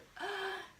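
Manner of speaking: sharp high gasp of horror; high appalled intake of breath; shocked gasp. Real, not acted. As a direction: gasp as if offended. Reacting to cry it out method.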